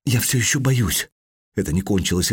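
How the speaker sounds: ordinary delivery, nothing marked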